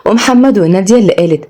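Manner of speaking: ordinary delivery, nothing marked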